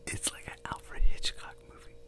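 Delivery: hushed whisper so the hotel can't hear. Real, not acted. Whispering in a shady hotel so neighbours won't complain. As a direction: keep it to a whisper. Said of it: "I gotta be a little bit quiet".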